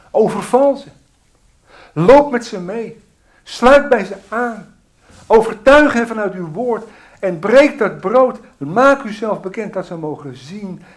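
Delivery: loud, urgent pleading to God; fervent loud prayer. Real, not acted. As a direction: pray it out with full force. Fervent prayer at full force. (luid en dringend gebed)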